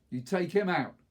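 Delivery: loud, high command; urgent order. Acted. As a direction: snap the order out. Aaron orders the shot.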